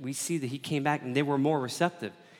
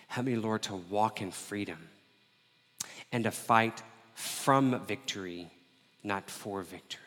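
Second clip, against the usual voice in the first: prayer; 2 semitones lower than usual. Acted. Leads a prayer.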